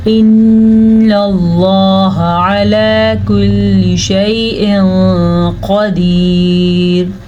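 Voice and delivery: very slow melodic chant; Quran recitation. Shown as a demonstration. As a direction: draw out each word. Recites verse.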